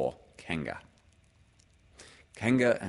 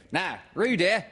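high, louder mother character; fussing, pitched well up; Kanga; 12 semitones higher, 6 dB louder than usual. Acted. Mother calling her child, high and fussing. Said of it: "said Kanga".

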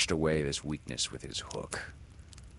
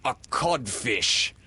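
slow, loud, higher verdict; mocking reply; 6 semitones higher, 5 dB louder than usual. A narrator's reading. Peter as Hook insulting the real captain, mocking. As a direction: pronounce the insult slowly as the captain. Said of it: "replied the voice".

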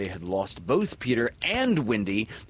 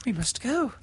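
put-on higher, lightly bright girl voice; almost bright. A narrator's reading. Windy to Peter on the rock, almost brightly. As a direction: say it almost cheerfully, unaware. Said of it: "she said almost brightly".

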